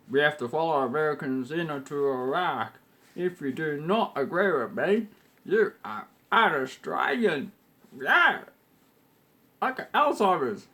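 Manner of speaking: pompous mock Prime Minister voice; mocking John Howard. Mocking Howard defending the Iraq war, pompous and loud. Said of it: "And John Howard".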